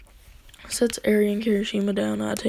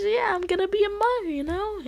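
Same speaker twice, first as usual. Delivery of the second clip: put-on girlfriend's voice; 11 semitones higher than usual. Kirishima voiced, much higher.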